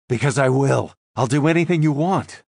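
cracking, pleading voice; voice breaking. Acted. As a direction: let the voice crack as you plead. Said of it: "my voice beginning to break".

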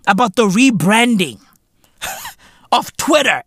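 ordinary delivery

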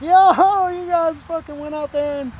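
high-pitched, loud-starting heckle; teasing imitation of scoffing locals; mocking jeer. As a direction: razz them like old drunks at the bar. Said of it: "just kinda laughing at us".

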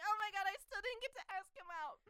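high, loud mock wail; frantic fretting. Heroine back at her apartment regretting it, frantic.

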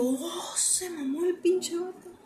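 high, slow whisper; whispered aside. Retold or imitated. Realizing the vendor cheated her, whispering. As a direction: whisper it in disbelief, slowly.

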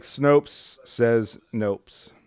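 very slow drawn-out punchline. Pretend. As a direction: stretch each word out slowly. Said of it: "And it's like".

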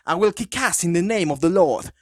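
put-on the corrected line, same over-the-top English accent; super English accent. Retelling the priest's entrance line, hammed-up English accent. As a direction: proclaim it like a posh English priest ready to brawl. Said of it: "con un acento súper, súper, súper inglés".

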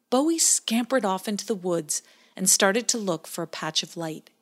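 ordinary delivery

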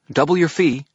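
high and loud, pressing; urgent. A narrator's reading. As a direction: push the offer urgently. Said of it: "her voice urgent".

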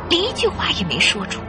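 usual speaking voice

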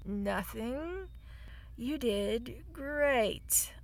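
quiet, slow, flat sarcasm trailing to a whisper; deadpan fake praise, very soft and slow; sarcastic fake calm. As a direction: say it through clenched politeness, sinking to a hiss. Baba hides her rage sarcastically.

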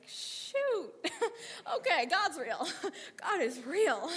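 high, loud, laughing disbelief; acted shock breaking into laughter; laughing amazement. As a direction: laugh it out as a stunned realization. Acted stunned realization that God is real.

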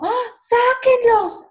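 high, slow shocked shriek; mock outraged shriek. Woman telling of being seen in public, mock shriek. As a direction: shriek it like a scandalized stranger. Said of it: "pero que no sea como".

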